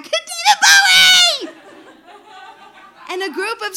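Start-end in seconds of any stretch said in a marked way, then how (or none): 0.0-1.5 loud, much higher, slow joyful shout; shouted back. Retold or imitated. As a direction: scream it back with glee. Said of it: "my friends and I shouted back".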